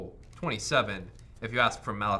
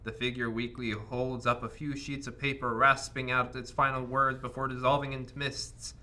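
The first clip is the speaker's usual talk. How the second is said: dramatic narration; 4 semitones higher, 4 dB louder than usual. Acted. Game master scene setting.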